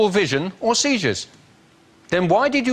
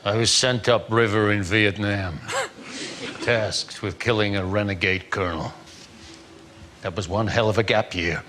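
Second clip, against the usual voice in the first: movie trailer voice; 8 semitones lower, 4 dB quieter than usual. Acted. Deep movie narration.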